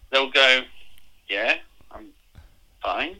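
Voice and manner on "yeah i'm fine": halting, softer, unsure answer; puzzled reply. Acted. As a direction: imitate a baffled Aussie, pausing before a questioning 'fine'. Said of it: "If I go, "All right," to someone here in Australia".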